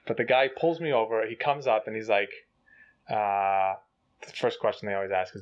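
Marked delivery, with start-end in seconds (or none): none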